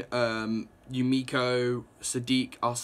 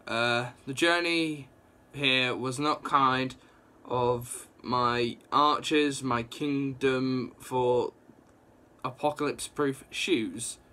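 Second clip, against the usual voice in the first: slow pompous drawl; slower than usual. Retold or imitated. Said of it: "he's like".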